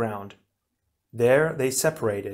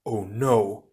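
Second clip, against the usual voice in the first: mild dismay; cried out. A narrator's reading. Onua sees the creatures join together, cried out in dismay. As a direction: cry out in dismay, held in. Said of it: "Onua cried".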